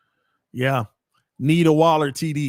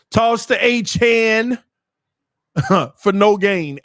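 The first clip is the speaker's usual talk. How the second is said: slow, raised, louder call; live play-by-play; 5 semitones higher, 6 dB louder, slower than usual. Real, not acted. Commentator calls a toss for no gain, play-by-play.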